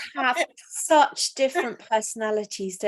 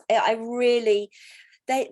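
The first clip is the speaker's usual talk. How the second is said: normal talking voice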